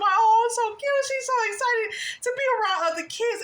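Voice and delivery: high-pitched, gushing delight over a little one; delighted cooing. Retold or imitated. Host gushing over the happy little girl, delighted cooing. Coos over an excited child.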